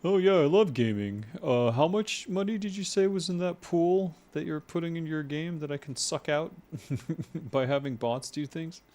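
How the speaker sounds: high, loud scornful gamer-bro voice; mocking fake gamer. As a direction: sneer it like a greedy crypto bro. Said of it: "Fake gamers".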